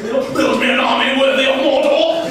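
put-on high, loud, slow toddler shout; toddler battle cry. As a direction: command it like a tiny dark lord. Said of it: "He was in preschool".

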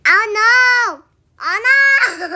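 loud, high cry of dismay; dismayed outcry. Real, not acted. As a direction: wail it out loud, half laughing at the end. Game mishap, calling out in dismay.

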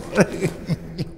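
breathy villain chuckle; evil laugh. Acted. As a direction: chuckle over your coming conquest. Villain bishop gloats.

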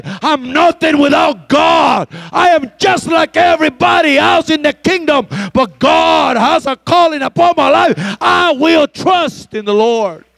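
high, loud, slow declaration; humble shouted declaration. Acted. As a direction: proclaim it slowly at full voice. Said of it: "you begin to say".

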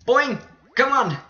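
high, loud exclamations; urgent cry. Real, not acted. Gamer willing the jump to work, urgent cry.

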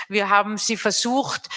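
normal talking voice

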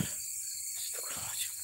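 barely voiced whisper; whispered prayer. Real, not acted. Ghost hunter prays to himself, whispering. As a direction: whisper it under your breath. Praying under the breath.

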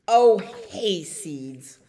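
loud, drawn-out cow grumble; lightly voiced lowing cow, slow and loud. A narrator's reading. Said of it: "lowed the cow".

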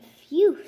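big loud relieved phew. A narrator's reading. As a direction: blow out in relief. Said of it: "said the dog".

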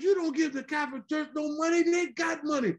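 dad ranting about the church's money, high-pitched; indignant dad. Retold or imitated. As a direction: rail against the donation. Father voiced in the story.

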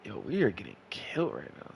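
hushed whisper. Real, not acted. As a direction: say it under your breath. Aside while losing.

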